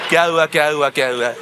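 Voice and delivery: a deflated, dropping busybody; nosy bystander again. Retold or imitated. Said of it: "वो आते हैं बीच वाले".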